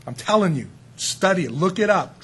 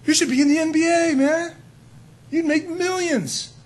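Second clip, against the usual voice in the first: much higher, excited praise; mock amazement; 11 semitones higher than usual. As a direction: hype the kid up like a star. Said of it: "I said".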